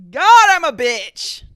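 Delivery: loud, high, drawn-out shout; self-mocking yell. Real, not acted. Host laughing at her own not caring, loud self-mocking yell. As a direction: belt it out after laughing at yourself.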